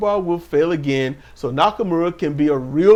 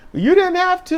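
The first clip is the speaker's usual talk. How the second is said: mock snippy retort; 14 semitones higher than usual. Jade's retort, acted.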